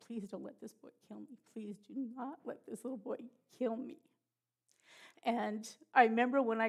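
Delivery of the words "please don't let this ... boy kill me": softer, barely voiced plea to God; hushed desperate prayer. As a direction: pray under your breath, lying still in fear. Silently praying under the knife.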